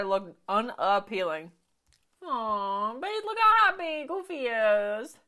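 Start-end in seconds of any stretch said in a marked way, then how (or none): 2.2-5.2 high, slow cooing to babe. Real, not acted. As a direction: coo as if to a cute pet. Cooing at cartoon dad.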